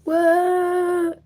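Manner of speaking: high, long-held whoop of a what; amazed. Real, not acted. Amazed at viewers from abroad.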